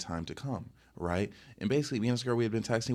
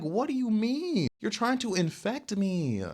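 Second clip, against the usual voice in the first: high, drawn-out protest; mock indignant; 12 semitones higher, slower than usual. Protesting to a woman moving in for a kiss, mock indignant. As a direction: stretch it out in high-pitched disbelief. Acted indignant retort to her.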